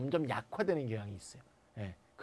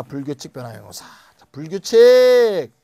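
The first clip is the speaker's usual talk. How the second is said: calling out heading; 13 dB louder than usual. Real, not acted. (크고 길게 끌며 제목 외치기)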